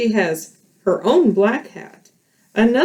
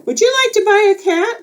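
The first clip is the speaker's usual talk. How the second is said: high, fast sales pitch; peddler asking; 11 semitones higher, 3 dB louder, faster than usual. Acted. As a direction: rush the offer up high. Peddler asks Bear.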